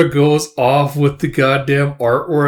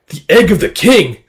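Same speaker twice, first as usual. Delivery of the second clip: higher, loud amazement, falling at the end; stunned recognition; 6 semitones higher, 6 dB louder than usual. Acted. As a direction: act a startled realization. Monster stunned by pendant.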